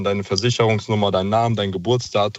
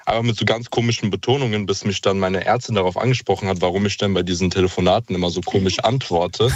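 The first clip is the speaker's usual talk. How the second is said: ordinary talk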